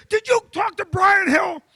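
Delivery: high, loud pointed question; demanding boss shout. Retold or imitated. Boss shouting at his nervous employee, loud and high. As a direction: grill him, shouting. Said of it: "And Billy goes".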